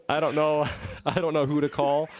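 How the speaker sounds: cracking up while reading aloud; laughing. Real, not acted. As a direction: struggle to read through real laughter. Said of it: "I'm not laughing at you, laughing with you".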